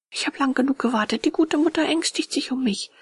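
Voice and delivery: put-on high-pitched, insistent boy puppet; anxious puppet boy. A narrator's reading. Puppet worries about waiting mother, high insistent boy voice.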